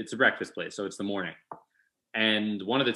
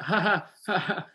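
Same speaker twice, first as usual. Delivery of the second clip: fake laugh; 9 semitones higher than usual. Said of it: "I just went".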